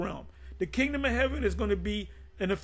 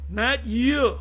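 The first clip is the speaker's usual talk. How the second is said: mock gossipy taunt, louder and far; Lewis Gossip voice; 2 semitones higher, 5 dB louder than usual. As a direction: tease it like a gossip. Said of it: "In my Lewis Gossip voice".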